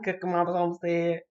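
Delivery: ordinary talk, nothing marked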